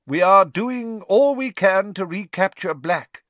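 high, loud ministerial announcement; official statement. Acted. Minister makes a statement to the wizarding press, high official voice. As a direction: proclaim it like a government minister.

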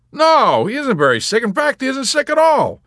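very high, loud outburst; emphatic denial. Acted. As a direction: shout it out brightly and high. Yossarian answering chaplain emphatically.

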